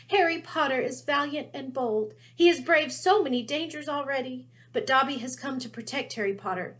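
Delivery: high, earnest, pleading elf; tearful house elf voice. A narrator's reading. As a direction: warn him, urgent and devoted.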